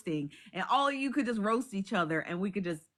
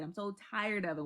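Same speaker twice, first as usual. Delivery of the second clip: softer, dropping away in weary defeat; quiet weary repeat; 3 semitones lower, 7 dB quieter than usual. Shown as a demonstration. To viewers, softly sighing that she's tired of holding laughs. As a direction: let it die out, worn down. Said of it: "repeated, fading".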